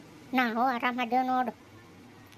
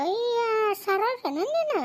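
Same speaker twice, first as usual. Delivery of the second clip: surprised; 8 semitones higher, slower than usual. Acted. Surprised to recognise Saroja.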